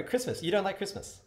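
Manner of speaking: ordinary talk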